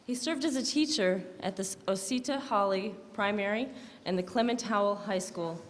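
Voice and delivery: normal talking voice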